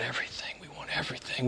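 whispered confession. Retold or imitated. Preacher voicing the disciples' honest answer, whispered. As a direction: breathe it out as a secret wish. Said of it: "they would have said".

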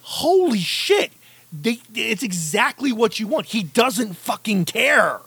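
mock amazement, stretched and high; a fan reliving the scene, high and slow; amazed. Retelling his own amazed reaction.

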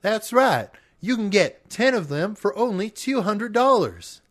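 high hammed-up sales pitch; home shopping host. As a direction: sell it like TV shopping. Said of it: "sent us a recording".

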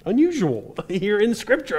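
ordinary talk

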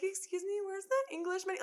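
high, entitled tourist asking for "Excuse me"; annoying tourist. Pretend. Annoying tourist demands an English menu, pitched high and entitled. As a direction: play the pushy American tourist. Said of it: "I wanted to be that annoying person".

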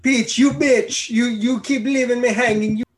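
high, loud, hammed-up Italian Mario voice; horrible Italian accent. Mario complaining at Peach, bad Italian accent. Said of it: "That's a horrible Italian accent".

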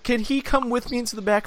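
high, timid, teary pleading; mock crying. Man mimicking Katie pleading with clinic staff, mock tearful. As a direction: imitate a frightened patient asking for company. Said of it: "She cries".

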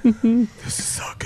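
a hushed whisper of delight, slowed down. Retold or imitated. Telling friends about the episode, a slow delighted whisper. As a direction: breathe it out softly like a thrilled fan. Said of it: "And I'm just like".

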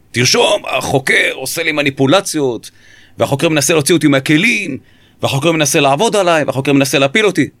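imitated suspect losing his cool, high-pitched; mock yell of indignant protest, complaint after complaint. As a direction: act out a high, agitated rant. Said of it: "והוא צועק לי, במין הרמת קול".

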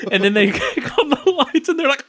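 ordinary talk, nothing marked

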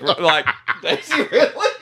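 laughing self-imitation. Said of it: "I'm like".

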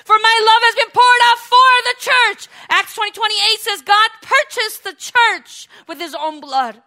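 high, loud, sustained sermon shout; full-force preaching. Real, not acted. Preacher expounding a verse to the congregation, at full force. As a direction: proclaim it slowly at full volume.

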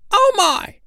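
very high, loud sandpiper squeal; startled tiny bird voice; exclaiming. A narrator's reading. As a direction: squeak in alarm. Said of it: "exclaimed Nipsy".

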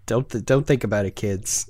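fast, dropping to a near hush; quick conspiratorial aside to kids. Acted. Aside to audience, hushed.

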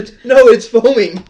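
loud, excited 'foaming' with a stressed 'no'. Real, not acted. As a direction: shout 'no, it's foaming' in surprise. Candy kit foaming over.

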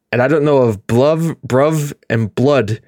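ordinary talk

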